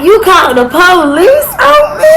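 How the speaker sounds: shocked, loud and high: you called the police on me; can't believe he called the cops; stunned disbelief. Retold or imitated. To the man on the phone, a loud stunned question. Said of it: "I'm like".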